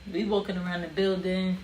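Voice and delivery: ordinary delivery, nothing marked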